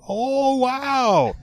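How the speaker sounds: high, drawn-out mock amazement; Robin Williams. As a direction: imitate a star's gushing interest. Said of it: "And Robin was like".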